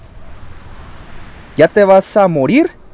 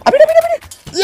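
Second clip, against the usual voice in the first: excited yell. Real, not acted. Gamer yelling at the fight, excited high yell.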